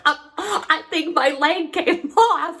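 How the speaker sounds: gasping, high hurt wail; mock agony. Fallen cyclist thinks her leg came off, mock agony. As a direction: act out panicked pain, half laughing. Re-enacts lying hurt after the fall.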